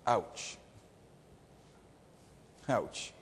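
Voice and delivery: low, hushed little reaction; understated ouch. Acted. Preacher reacts to a stinging verse, quiet and understated. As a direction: drop it softly, with a gap between.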